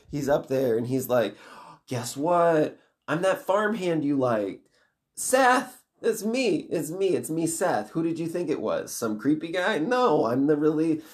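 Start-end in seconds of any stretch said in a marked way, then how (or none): 1.9-11.0 high, teasing villain voice; gleeful villain reveal. Acted. Villain reveals he was the farmhand, gleeful and high. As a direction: play the gloating trickster, pitched well up.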